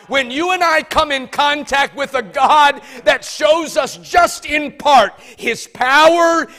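loud, slow, rising preacher's cadence; preaching. Acted. Preacher rousing the congregation, booming sermon voice. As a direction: preach it out to the whole house.

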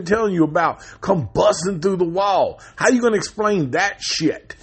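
high, incredulous rhetorical questions; incredulous rant. Real, not acted. Movie fan defending the uncle's speechlessness, incredulous. Ranting in mock disbelief at werewolf.

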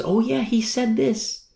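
high, slow voice of sudden recall; dawning realization. Retold or imitated. Disciples recalling what Jesus told them, dawning realization. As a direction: act out the penny dropping. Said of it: "then they realize".

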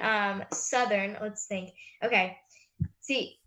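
ordinary delivery

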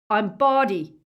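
high, loud, thick-tongued protest; stuffed-up, muffled consonants, pitched high; mouth full of blood. A narrator's reading. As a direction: blurt it through a bleeding mouth. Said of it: "his mouth was full of blood".

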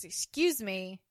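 ordinary talk, nothing marked

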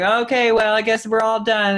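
a light high-pitched fake crowd voice; mock crowd voice. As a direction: toss it off like a crowd feigning boredom.

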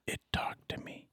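creepy unvoiced whisper. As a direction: hush it like a secret. Creepy reveal to the party.

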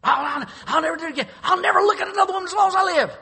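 high shouted hammed-up oath, fast; mock desperate vow. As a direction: wail the empty promise over the top. Mock fake repentance shouted.

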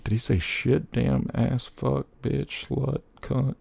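hushed list of swear words; inner voice. Retold or imitated. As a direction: whisper the curse list to yourself. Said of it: "so my head's like".

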